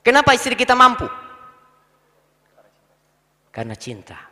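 ordinary talk, nothing marked

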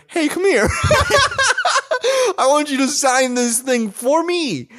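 high, laughing, cocky demand; smooth talker. Retold or imitated. Friend mocking the bold fan, laughing and cocky. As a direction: act cocky and slow. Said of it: "you gotta be a smooth ass motherfucker".

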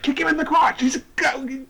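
high excited urging; cheering on. Retold or imitated. Watching the fight, urging her on in a high excited voice. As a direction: yell advice at the screen.

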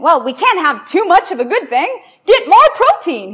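hammed-up discoverer, carried away by his find; mock excited scientist. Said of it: "He said".

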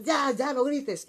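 high, loud yell of no grites; shouting back. Retold or imitated. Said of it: "y yo también grito". (gritando con fuerza)

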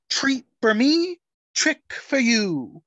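hammed-up theatrical villain; performative villain voice. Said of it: "Performative bitch".

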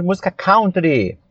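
"country" with an OW vowel, like "cown-tree"; "country" rhyming its first syllable with "down"; Brazilian-style pronunciation. Shown as a demonstration. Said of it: "temos essa cultura de falar música country".

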